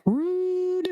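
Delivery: high, prissy put-out voice of a talking head; a high, offended head on a stick; snooty severed head. Acted. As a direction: sniff at the rude interruption, high and huffy. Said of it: "the other one's like".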